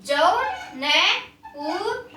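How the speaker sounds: Jaunpur stretched very slowly with a slight laugh; slow sounding out. Shown as a demonstration. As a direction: draw out Jaunpur for the class. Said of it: "आप भी बेटा साथ साथ बोलेंगे".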